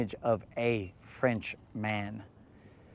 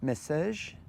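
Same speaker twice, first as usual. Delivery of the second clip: hammed-up French accent asking the phone to text; jokey French accent talking to the phone; mock French accent; 4 semitones higher, 4 dB louder, slower than usual. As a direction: poke fun at a friend with a put-on French accent. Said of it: "I just assume you have a French accent".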